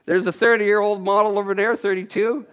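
usual speaking voice

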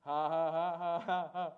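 big deliberate belly laugh; loud staged laugh. Shown as a demonstration. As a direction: laugh loudly on purpose, ha-ha-ha. Laughing in the face of trouble.

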